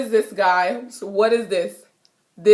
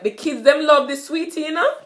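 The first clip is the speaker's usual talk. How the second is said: Jamaican patois, pitched higher; 7 semitones higher than usual. Shown as a demonstration. Teacher giving an example sentence in Jamaican patois. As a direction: speak it like a Jamaican kid. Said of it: "you could be like".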